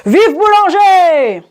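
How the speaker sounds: long high shout, falling at the end; drawn-out cry. A narrator's reading. Disgruntled passenger missing the omnibus, drawn-out shouted cry. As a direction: holler it slowly, stretching every syllable. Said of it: "un cri de".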